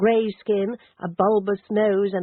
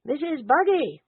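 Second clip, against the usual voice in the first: higher calling shout down the hall; 9 semitones higher than usual. Acted. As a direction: call her from the study door. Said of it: "and shouted".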